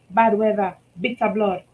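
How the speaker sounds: plain reading voice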